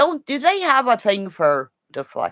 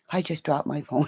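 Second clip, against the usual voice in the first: ordinary delivery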